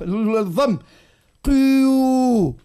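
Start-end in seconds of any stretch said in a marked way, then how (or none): 1.4-2.6 قيو stretched very slowly and loudly, sliding from i to u; overdone two-vowel قيو; drawn-out mistake. Shown as a demonstration. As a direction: drag قيو out to show two separate vowels. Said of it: "ريتو".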